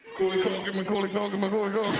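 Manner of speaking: blurted, run-together repetition; compulsive blurting. Acted. Starstruck colleague trying to greet Mac casually, compulsive blurting. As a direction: rattle the name off in a rapid loop.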